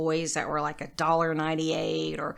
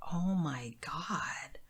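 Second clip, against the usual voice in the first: slow, hushed, drawn-out disbelief; stunned awe; 7 dB quieter, much slower than usual. Retold or imitated. Retelling the moment of the sign, stunned and hushed. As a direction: let it out slowly under the breath.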